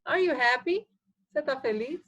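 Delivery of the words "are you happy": rising question tune on happy, a bit higher; question intonation. Shown as a demonstration. Teacher showing students how an English question rises. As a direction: lift the end like a real question. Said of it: "fazer essa entonação de pergunta".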